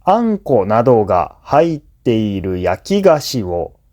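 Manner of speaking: ordinary delivery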